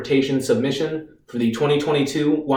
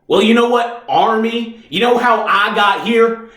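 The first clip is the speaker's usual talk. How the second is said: higher-pitched, mounting outrage; angry accusation; 5 semitones higher than usual. Acted. Reeves blames the Army lawyer for taking him, angry and accusing.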